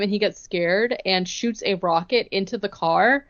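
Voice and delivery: ordinary talk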